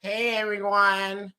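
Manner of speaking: slow drawn-out mocking echo of the clip's "Hey, everyone"; mock greeting. Streamer mocking the video creator's opening, slow mimicry. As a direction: parrot the clip's greeting slowly with scorn.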